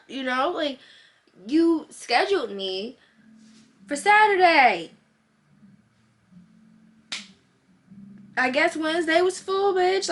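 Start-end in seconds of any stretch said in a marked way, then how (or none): 1.5-10.1 angry rant. Real, not acted. Ranting at the receptionist.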